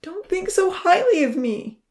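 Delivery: high, loud anguished plea; on the verge of sobbing. A narrator's reading. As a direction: plead as tears take over. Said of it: "And then he began to sob".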